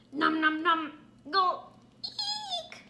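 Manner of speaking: chomp, then shriek in fright; munch then squeal. Acted. Contestant fleeing being eaten.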